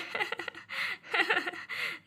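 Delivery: a soft, light laugh of relief; mother laughing softly after the children recover; relieved laugh. Acted. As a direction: laugh gently once the girls are fine. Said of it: "Neha हंसने लगती है".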